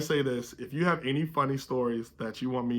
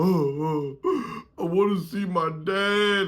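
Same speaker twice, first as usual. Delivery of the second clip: put-on drunk kid whining; 5 semitones higher, 3 dB louder, slower than usual.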